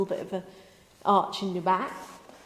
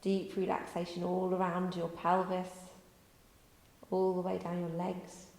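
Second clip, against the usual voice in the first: guided relaxation; 4 dB quieter than usual. Acted. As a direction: lull them down into stillness.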